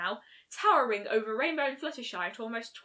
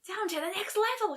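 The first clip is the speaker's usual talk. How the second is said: higher, urgent shout; 9 semitones higher than usual. A narrator's reading. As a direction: call it out to the others. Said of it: "she shouted".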